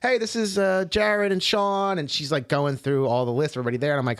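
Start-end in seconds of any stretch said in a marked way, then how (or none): 0.0-2.0 [name]. Retold or imitated. Imitates host wife introducing guests.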